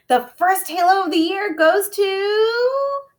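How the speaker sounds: high, loud announcing voice; big deal announcement. A narrator's reading. Teacher announcing the halo winner to class, grand announcement. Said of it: "her special This Is a Big Deal voice".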